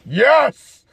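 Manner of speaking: high, celebratory exclamation; triumphant cheer. Acted. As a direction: crow it like a win. Celebrates getting her phone number.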